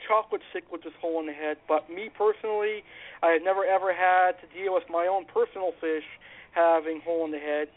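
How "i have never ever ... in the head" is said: ordinary talk, nothing marked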